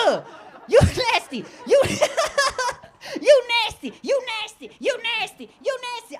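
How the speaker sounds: high, mock-revolted chant; freaked-out girlfriend. Girl scolding him for liking feet, high disgusted voice. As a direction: imitate her squealing, repeated disgust. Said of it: "She freaked out. She's like".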